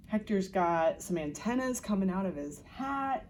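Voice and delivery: usual speaking voice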